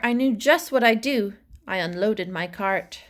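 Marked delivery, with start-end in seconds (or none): none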